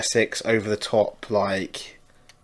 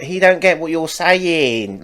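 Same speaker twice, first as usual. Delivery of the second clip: higher, slow, teasing; teasing shop girl; 6 semitones higher, slower than usual. Retold or imitated. Shop girl telling customer, slow teasing mock. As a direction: spell it out mockingly, slowly. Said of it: "she was like".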